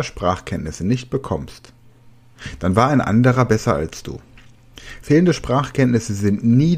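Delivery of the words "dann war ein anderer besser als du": ordinary delivery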